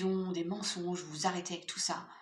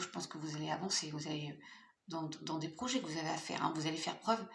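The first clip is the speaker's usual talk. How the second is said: ordinary talk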